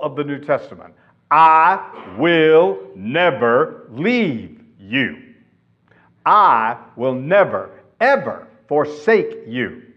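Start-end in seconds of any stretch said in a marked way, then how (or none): 1.3-9.8 slow, loud, "never" and "ever" set apart; most emphatic. Shown as a demonstration. Preacher renders the Greek double negative, "never" slow and emphatic. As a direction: pound out each word, stressing "never, ever". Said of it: "This is the most emphatic statement".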